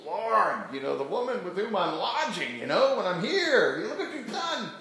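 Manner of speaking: high, laughing mock complaint to God; Elijah complaining. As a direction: whine it up high through a laugh like a fed-up prophet. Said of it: "he says".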